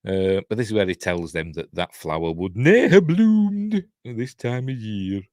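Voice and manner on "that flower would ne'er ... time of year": put-on Scottish accent. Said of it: "That's my Scottish accent".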